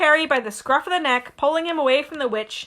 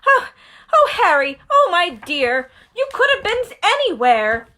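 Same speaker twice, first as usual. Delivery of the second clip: fussing mother; 6 semitones higher, 5 dB louder than usual. Acted. Mrs Weasley relieved to find Harry, fretting. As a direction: fuss over him like a worried mum.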